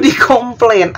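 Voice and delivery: ordinary talk, nothing marked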